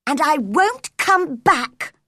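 high-pitched, defiant parting shout; shouted reply. A narrator's reading. Girl storming out on the boy, shouting back. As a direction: fling it back angrily. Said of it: "Mary shouted in reply".

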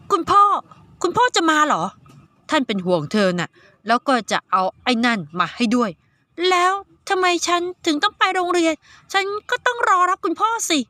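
put-on a girl perking up at news of her dad; girl's voice.

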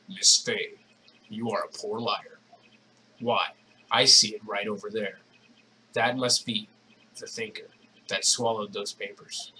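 lightly voiced low villain; a hint of a sinister stranger; masked villain voice. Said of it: "it's like the masked voice".

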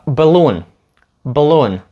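"Balloon" as "b'loon"; reduced BALLOON. Shown as a demonstration. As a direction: barely voice the first vowel of "Balloon". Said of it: "Ми кажемо balloon".

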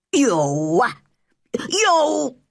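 put-on spluttering old gardener, mildly shouted; spluttered shout. A narrator's reading. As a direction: splutter it out in two separate bursts. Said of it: "He stopped shouting".

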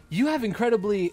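high, loud teasing echo of the line; high mock repeat. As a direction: squeak it back mockingly. Repeating the date's line, laughing.

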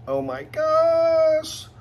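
high, slow, stretched squeal of delight; excited squeal. Real, not acted. Vlogger unboxing her favorite bag, delighted high squeal. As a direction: squeal it out like you just opened a gift.

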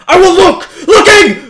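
high, loud nervous shout with a laugh; yelling to beat the fear; scared laughing yell. Real, not acted. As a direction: bravado cracking into panic. Forcing himself to look.

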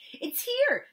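put-on high, loud, excited child calling out; a small boy bursting with news. A narrator's reading. Matt calling to Kira, a loud excited child's shout. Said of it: "Matt called".

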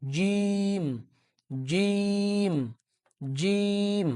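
জিম drawn out with a firm j; hard jim. Shown as a demonstration. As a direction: say জিম hard and held long. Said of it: "hard বা শক্ত করে আমরা পড়ব".

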